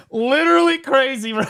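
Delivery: ordinary delivery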